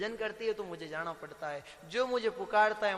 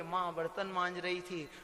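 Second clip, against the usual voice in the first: normal talking voice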